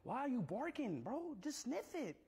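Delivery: high, swooping whine; whiny, high-pitched exasperation; exasperated pleading. Real, not acted. Pleading with barking dog.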